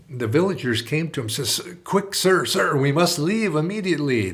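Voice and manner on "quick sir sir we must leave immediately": higher, louder, breathless urgency; urgent plea. Retold or imitated. Villagers begging the priest to flee, urgent and louder. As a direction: hurry him out before the army comes.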